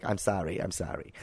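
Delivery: low, soft mock apology; meek apology. FBI agent backing down to activist, imitated meek apology. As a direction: shrink the voice down as you apologise.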